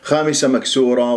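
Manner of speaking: normal talking voice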